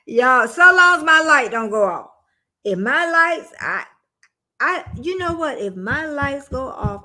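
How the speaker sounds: ordinary talk, nothing marked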